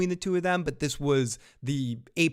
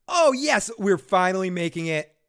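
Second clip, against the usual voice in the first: excited; 5 semitones higher, 6 dB louder than usual. Real, not acted. Excited at donations.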